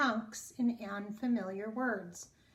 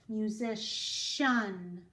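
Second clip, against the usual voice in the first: "Musician" said very slowly; slow demo word; much slower than usual. Shown as a demonstration. Teacher rereading the word slowly. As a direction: stretch out "Musician". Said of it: "the word musician".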